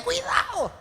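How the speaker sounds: high, sudden warning call; warning cry. Retold or imitated. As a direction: cry out to stop a child falling. Parent shouting warning to child.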